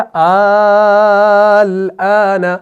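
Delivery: अल आना with the first vowel stretched extremely long; long mad. Shown as a demonstration. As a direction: chant अल आना, holding the vowel as in recitation. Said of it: "अल आना से क्या बन गया?".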